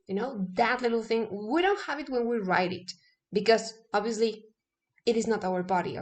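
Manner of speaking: ordinary delivery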